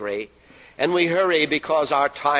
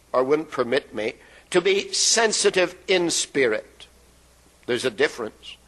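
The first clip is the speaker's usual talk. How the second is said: ordinary talk, nothing marked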